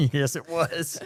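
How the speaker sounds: agreeing through real laughter; laughing. Real, not acted. Cohost laughing along about writing off the beach trip. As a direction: laugh the words out.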